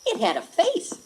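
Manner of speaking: usual speaking voice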